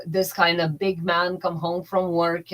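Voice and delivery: normal talking voice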